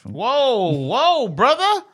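rising shocked whoa; big incredulous whoa; surprised. Real, not acted. Reacting to low rating.

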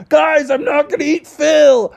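high, slow pleading bear voice; earnest reassurance. Acted. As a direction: plead calmly that you're harmless. Bubby reassures townspeople.

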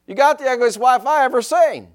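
high, loud squawk of a rude parrot; mock parrot squawk. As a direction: pitch it up like a cheeky bird. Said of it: "That parrot goes".

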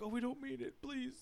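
high, very soft aside; secretive mock apology, barely voiced; hushed plea.

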